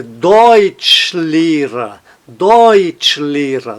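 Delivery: very slow Deutschlehrer, DEUTSCH strongest, LEH lighter; stress on Deutsch. Shown as a demonstration. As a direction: draw out Deutschlehrer, leaning on Deutsch. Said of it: "a primeira fica um pouquinho mais forte". (Deutschlehrer bem devagar, Deutsch mais forte)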